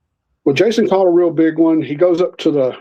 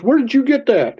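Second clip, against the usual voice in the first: higher, indignant questioning; retold anger; 8 semitones higher than usual. Angry boss questioning boy about the fish, mock indignant. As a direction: imitate an angry boss demanding an answer. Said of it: "because Jerry was mad".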